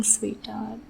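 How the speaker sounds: whispered sweetheart. Acted. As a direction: breathe it softly. Whispered pet name.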